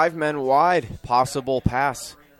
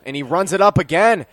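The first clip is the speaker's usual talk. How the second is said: mildly excited call; 7 semitones higher, 6 dB louder than usual. Real, not acted. Live call of another run.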